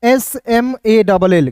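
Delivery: letters "एस एम ए डबल एल" spelled out slowly; slow spelling. Shown as a demonstration. Teacher spelling small for students, slow and clear. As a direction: spell each letter with a pause. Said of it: "बिल्कुल ध्यान से देखिएगा".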